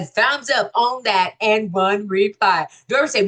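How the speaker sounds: ordinary talk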